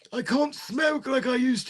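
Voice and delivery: high, put-on old-timer lament; old person. Lamenting old smoking days in a high mock old-timer voice. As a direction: wail it like a mock old codger. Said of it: "because I'm getting old".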